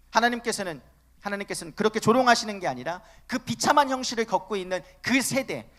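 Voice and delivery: ordinary delivery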